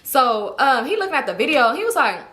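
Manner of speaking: ordinary delivery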